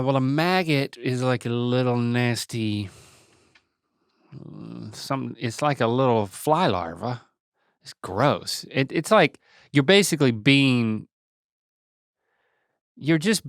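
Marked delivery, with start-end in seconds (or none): none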